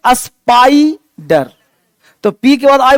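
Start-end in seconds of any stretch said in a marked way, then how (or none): 0.1-1.5 स्पाइडर drawn out very slowly, syllable by syllable; slow pronunciation demo. Shown as a demonstration. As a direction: spell out स्पाइडर slowly for the class. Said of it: "तो इसको क्या पढ़ेंगे". (स्पाइडर को बहुत धीरे, एक एक हिस्सा बोलकर)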